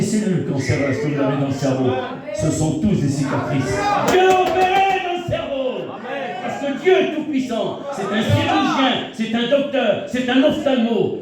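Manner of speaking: high, loud proclaiming of God's power; preaching at full force. Acted. Preacher proclaiming to the congregation, loud and high. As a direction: cry out that God is the surgeon.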